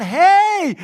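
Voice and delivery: high, full-force shout for help; yelled call. Retold or imitated. Wounded woman calls to a passing car, yelling out. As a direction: holler it long and loud to stop a car. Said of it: "she yelled out".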